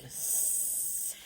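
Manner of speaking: long, soft, drawn-out sss; snake hiss. Shown as a demonstration. Teacher showing pupils the stretched S, soft snake hiss. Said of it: "by stretching that S out".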